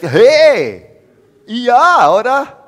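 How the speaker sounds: very loud, high, brash hey; pushy doorstep caller. Retold or imitated. God contrasted with a loud, pushy figure at the doorstep. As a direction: barge in like someone at the door. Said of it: "in der Haustür steht und sagt".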